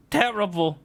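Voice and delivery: high-pitched squeak on Terrible; high squeaky echo. Shown as a demonstration. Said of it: "I'm terrible".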